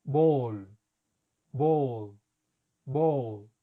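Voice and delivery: "Ball" with an open, long "O"; English long O. Shown as a demonstration. Teacher modeling the word ball. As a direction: model "ball" slowly and clearly. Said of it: "Sigamos con los ejemplos".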